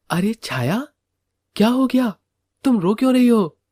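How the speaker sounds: lightly voiced husband. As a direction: gently ask your crying wife. (पत्नी से चिंता में पूछता पति)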